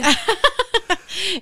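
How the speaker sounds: loud high peal of laughter; real laughter. Real, not acted. Laughing at being asked to try the voice, real loud laugh.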